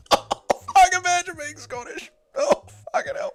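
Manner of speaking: high, laughing mock voice; shrill laughing taunt; mocking squeal. Mocking Scots.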